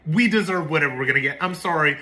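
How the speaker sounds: ordinary delivery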